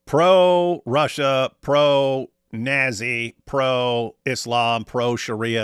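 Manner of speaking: very slow, spaced-out listing; drawn-out list. Real, not acted. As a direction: stretch out each item for emphasis. Listing the stances with deliberate emphasis.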